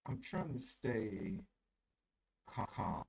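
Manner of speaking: muffled, garbled bad connection; underwater audio. Real, not acted. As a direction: sounds like talking underwater. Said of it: "It sound like your audio is underwater".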